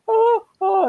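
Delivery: high, loud mock groan of pain; Evil Ed gasp. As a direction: gasp it out high like a turning vampire. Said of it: "and he's like".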